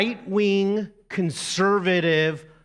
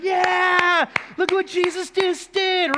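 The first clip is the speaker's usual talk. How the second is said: excited church cheer; 13 semitones higher than usual. Retold or imitated. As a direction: cheer like a thrilled congregation. Congregation cheering Jesus.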